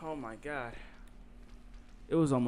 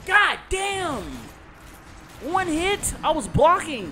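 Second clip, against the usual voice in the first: yelling high and loud in rage; furious shout; 12 dB louder, slower than usual. Real, not acted. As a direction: scream it out at the game. Raging at game death.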